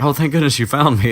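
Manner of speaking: high, loud, gushing relief; mock relief. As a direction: gush as if just rescued, imitating. Neville relieved at being found.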